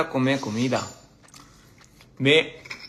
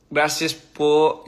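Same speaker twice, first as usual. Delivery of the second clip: very slow, careful syllables; careful learner's pronunciation; slow sounding out; 2 semitones higher, 4 dB louder, much slower than usual. Shown as a demonstration. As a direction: drag out each syllable. Sounding out Spanish words.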